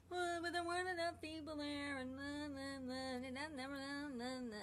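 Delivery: high, nagging inner voice; lightly voiced grumbling critic, na na; mocking inner critic. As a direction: mimic your own whiny self-doubt.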